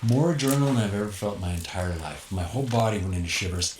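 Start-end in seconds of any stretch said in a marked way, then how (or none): none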